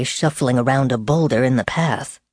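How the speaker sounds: plain reading voice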